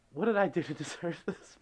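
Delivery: normal talking voice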